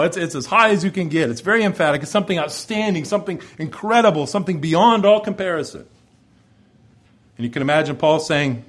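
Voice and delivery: usual speaking voice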